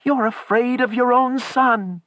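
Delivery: very high, loud reproach; frantic accusation. A narrator's reading. Wife accuses husband of fearing son, frantic reproach.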